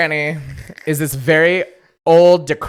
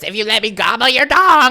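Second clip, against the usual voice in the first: high, loud crone voice; old granny voice; 8 semitones higher, 6 dB louder than usual. Retold or imitated. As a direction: croak it like a leering old granny. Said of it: "old granny voice".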